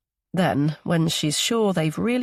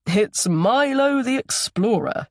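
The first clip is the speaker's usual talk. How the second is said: higher, lively mock announcement; playful greeting; 5 semitones higher, 4 dB louder than usual. A narrator's reading. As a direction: greet a small grandson with playful fanfare. Grandfather greets boy flying at him.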